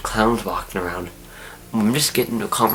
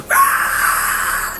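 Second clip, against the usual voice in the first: very high, breathy mock shriek; long squealed scream of coaster terror; imitated scream; 4 dB louder than usual. Cassie screaming on the coaster ride, shrill mock shriek. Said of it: "she goes".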